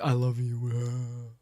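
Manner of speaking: drawn-out slow-motion movie line; imitated slow-mo declaration, trailing off softly; mock slow motion. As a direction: stretch the words into a fading cry. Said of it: "And it's, like, slow motion".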